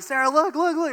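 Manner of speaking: excited calling out. Retold or imitated. Excited husband pointing out lake.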